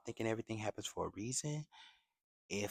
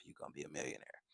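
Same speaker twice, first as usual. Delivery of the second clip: usual speaking voice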